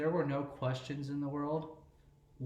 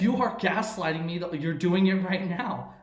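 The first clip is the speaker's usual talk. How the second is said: raised voice, high and loud; loud exasperated; 5 semitones higher, 8 dB louder than usual. Real, not acted. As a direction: protest loudly. Accusing cohost of gaslighting.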